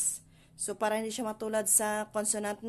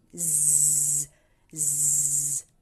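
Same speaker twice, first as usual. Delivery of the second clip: long low bee-like "zzz"; bumblebee buzz; 7 semitones lower than usual. Shown as a demonstration. Teacher imitates a bee for the letter, low buzz. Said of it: "Parang bubuyog".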